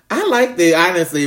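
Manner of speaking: giddy confession. Real, not acted. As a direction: blurt it out, thrilled. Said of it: "I was just nervous and shy".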